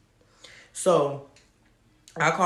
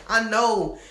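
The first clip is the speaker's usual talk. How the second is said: laughing; 6 semitones higher, 4 dB louder than usual. Real, not acted. Laughing retelling injuries.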